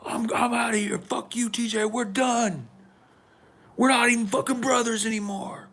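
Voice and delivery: high, heated mock rant; walk out cursing his brother; storming off. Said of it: "he's like".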